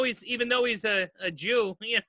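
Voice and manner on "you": normal talking voice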